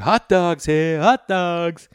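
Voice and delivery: loud, high street vendor hawking; vendor calling out. Retold or imitated. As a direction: call it out like a ballpark seller. Hot dog vendor calling out.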